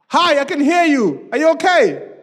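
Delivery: high, loud distant shout; stretched-out shouted reply; calling back. Acted. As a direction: holler down to someone far off. Voice calls back to cliff.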